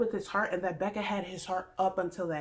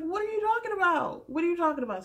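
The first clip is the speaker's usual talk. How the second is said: higher, baffled demand; 9 semitones higher, 3 dB louder than usual. Retold or imitated. Demanding answers from Caroline, higher and baffled. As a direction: ask it up high, bewildered. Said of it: "I was literally like".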